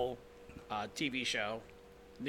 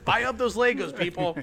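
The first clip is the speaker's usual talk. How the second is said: home shopping host; 4 semitones higher, 6 dB louder than usual. Pretend. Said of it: "mock sales pitch".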